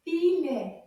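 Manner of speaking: "Filet" loud, with syllables held apart; slow model. Shown as a demonstration. Teacher shows children the word "Filet", measured and split. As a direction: separate the two syllables of "Filet". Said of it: "say it a little bit faster".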